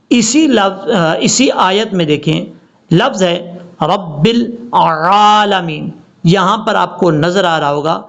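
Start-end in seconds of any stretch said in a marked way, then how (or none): 3.8-5.9 slow 'رب العالمین' with throaty ع; clear ain. Shown as a demonstration. Teacher points out the letter, slow correct recitation. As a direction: squeeze and hold the ع of 'العالمین'. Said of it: "یہ عین ہے لام کے بعد".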